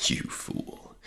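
low shocked whisper; stunned whisper. A narrator's reading. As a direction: breathe it out in shock. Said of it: "he answered in a low, shocked voice".

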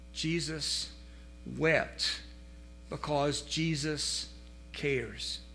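soft, slow, low and tender; tender emphasis. Acted. Pastor comforting the congregation, tender and slow. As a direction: let each word fall gently.

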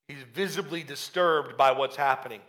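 ordinary talk

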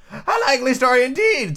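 high, scornful squawk; contemptuous pigeon. Acted. Pigeon disbelieving Alice, with high-pitched contempt. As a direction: scoff it in a high bird voice. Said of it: "in a tone of deepest contempt".

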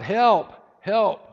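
loud, high desperate shout; yelled cry for help. Acted. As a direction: yell it out like someone hanging from a cliff. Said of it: "he yelled out".

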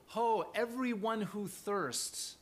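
"Ho" lifted high and slow; a heartfelt slow call of "Ho"; impassioned appeal. Shown as a demonstration. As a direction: reach out to a thirsty people. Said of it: "real emotion going on here".

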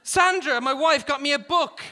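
high, loud encouragement across a distance; calling out. A narrator's reading. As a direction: shout advice to a fellow dieter. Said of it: "Garrison called".

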